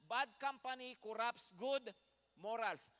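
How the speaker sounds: slow emphasis. Shown as a demonstration. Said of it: "Tandaan niyo mabuti yun".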